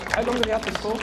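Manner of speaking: ordinary talk, nothing marked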